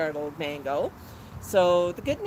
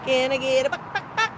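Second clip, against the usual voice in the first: coaxing clucks; 7 semitones higher than usual. Pretend. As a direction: cluck like a hen to get an answer. Coaxing the bird to cluck back.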